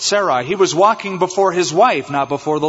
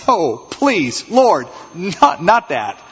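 mock pleading; 3 semitones higher, much slower than usual. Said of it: "you can just see Abraham going".

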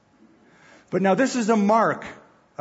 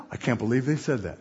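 softer, quick, lightly put-on voice of disbelief; mock shocked God; 4 semitones lower, 4 dB quieter, faster than usual. God pretending to be stunned by Israel, mock shocked. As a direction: rattle it off like a scandalized onlooker.

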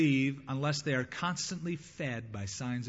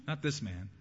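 ordinary delivery

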